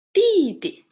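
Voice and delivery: "弟弟" loud, starting very high and falling; model pronunciation. Shown as a demonstration. Teacher modelling younger brother for learners, model pronunciation. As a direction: throw "弟弟" high, then drop it.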